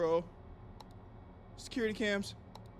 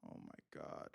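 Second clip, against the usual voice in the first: shocked whisper; 16 dB quieter than usual. Real, not acted. Scared by knocking.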